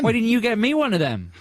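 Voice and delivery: high whiny repeat of co-host's line; mocking echo. Co-host mimicking his partner's complaint in a high whine. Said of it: "mocking repeat of co-host".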